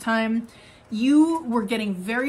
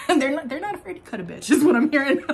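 laughing; 4 semitones higher, 4 dB louder, faster than usual. Real, not acted. Laughing at her own words.

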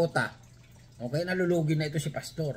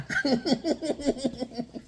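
laughing; 10 semitones higher than usual. Real, not acted. Laughing at own joke.

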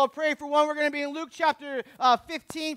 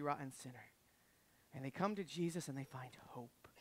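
very low, soft sermon voice; hushed preaching; 9 semitones lower, 16 dB quieter than usual. Real, not acted. Preacher on sinners finding hope, hushed. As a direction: sink to a near-murmur, low and grave.

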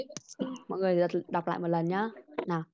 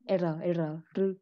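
normal talking voice

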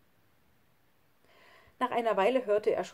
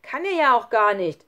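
loud, raised retort; angry scolding; 4 semitones higher, 11 dB louder than usual. A narrator's reading. Schlunz argues back at Lukas, angry. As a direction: snap back in the quarrel. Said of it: "schimpfte jetzt auch Schlunz".

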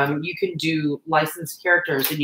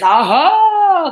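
triumphant; 11 semitones higher, 8 dB louder than usual. Real, not acted. Finding the lost Sharpie.

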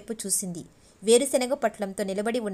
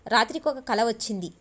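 plain reading voice

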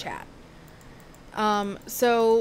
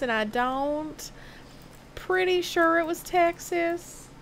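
put-on Texas accent. Streamer recalls play while chatting, Texas accent. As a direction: hold a playful Texas Southern accent. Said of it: "I'd rather do a Texas accent".

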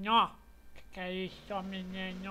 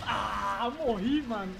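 usual speaking voice

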